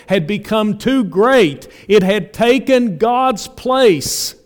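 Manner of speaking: loud, driving sermon delivery; full-force preaching. Real, not acted. Preacher answering how to fight idolatry, full-force preaching.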